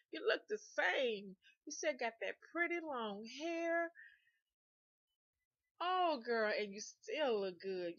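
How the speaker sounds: drawn-out, emphatic flattery; gushing admiration. Retold or imitated. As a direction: imitate an admirer laying it on thick. Ex gushes over her looks.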